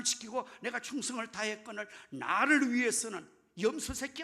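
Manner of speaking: loud, high-pitched outraged protest; angry complaint. Acted. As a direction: pour out your grievance at full volume, as an indignant son. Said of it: "화를 내고 분노하고 불평을 털어놓고". (화나서 큰 소리로 불평을 쏟아내듯)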